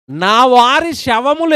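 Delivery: preacher proclaiming scripture slowly; solemn scripture reading. Acted. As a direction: stretch every word of the verse out. Repeats verse phrase.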